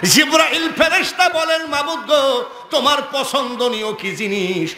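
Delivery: high proclaimed question settling downward; forceful preaching. Acted. Preacher voicing Gabriel's question to God, high forceful preaching.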